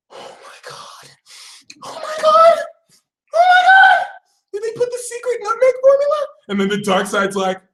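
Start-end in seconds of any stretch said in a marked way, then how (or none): 0.1-1.1 whispered, stunned by the taste; voiceless gasp of wonder; awestruck whisper. Acted. Sagittarius tastes the cookie. 1.8-2.6 very high, loud, tearful squeal; shrieking, blissed-out amazement; overwhelmed squeal. Acted. Sagittarius overwhelmed by cookie. 3.3-6.3 extremely high, hammed-up wail; shrieking cookie rapture; ecstatic squeal. Sagittarius overwhelmed by cookie.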